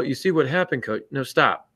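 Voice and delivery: ordinary talk, nothing marked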